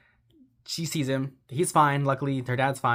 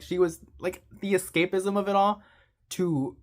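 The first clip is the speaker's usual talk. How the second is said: ordinary talk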